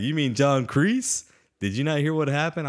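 a hint of a character's disbelief; imitating a character. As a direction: play the smug reveal. Imitating character's smug reveal.

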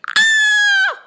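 very high, loud shriek; long piercing shriek; horrified scream. Acted. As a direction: scream at the sight of monsters. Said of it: "she screamed".

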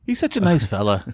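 put-on "He's such a nice fella" in a half-hearted Canadian accent with a bit of Irish; wobbly Canadian accent. Host joining the Canadian bit in a shaky accent. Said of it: "I got a little bit of Irish mixing in with my Canadian".

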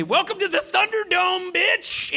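high, strained action-hero yell; battle cry. Acted. Player charges the monster with broadsword, high battle cry. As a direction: holler it as you charge. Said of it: "I say".

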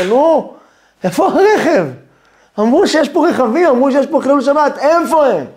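impatient zealot. Acted. Modern zealot impatient, mocking.

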